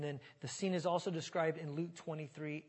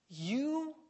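"You?" alone, swooping up high; trying emphasis on YOU; 9 semitones higher, 3 dB louder than usual. Shown as a demonstration. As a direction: lift "You?" into a high, drawn-out question. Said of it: "I don't know where to put the emphasis".

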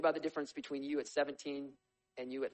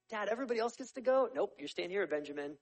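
a lightly voiced whiny kid and stern dad, higher; bratty younger brother; 8 semitones higher, 5 dB louder than usual. Kid begging dad to go, lightly voiced. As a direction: plead then get shut down.

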